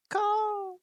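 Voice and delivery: high crying caw; a harsh bird cry; raven caw. Acted. Raven caws mid-warning, high and sharp.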